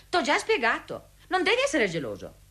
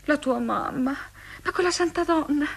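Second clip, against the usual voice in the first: ordinary talk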